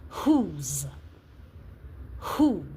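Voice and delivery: "Whose" and "who" said with a clear breathy h at the start; H sound stressed. Shown as a demonstration. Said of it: "the emphasis is on the H sound".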